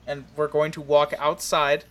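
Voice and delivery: ordinary talk, nothing marked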